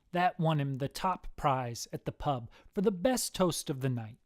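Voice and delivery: ordinary delivery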